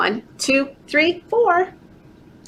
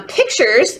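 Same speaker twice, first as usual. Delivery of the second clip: plain reading voice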